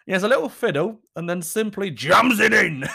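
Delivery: laughing through it. Real, not acted. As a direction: lose it laughing mid-sentence.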